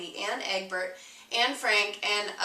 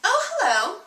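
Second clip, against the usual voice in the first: bright, much higher greeting; cheery hello; 11 semitones higher, 8 dB louder, faster than usual. A narrator's reading. Egg narrator greeting the reader, bright and high. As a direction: greet the reader with a big smile.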